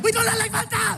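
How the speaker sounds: German heckler. Retold or imitated. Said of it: "the guy was like".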